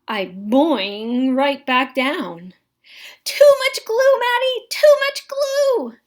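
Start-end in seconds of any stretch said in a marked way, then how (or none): 3.2-6.0 high, loud panicked squawk; teacher squawking. A narrator's reading. Teacher panicking at the glue-covered boy, high squawk. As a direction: squawk it out like a frantic bird. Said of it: "my teacher squawks".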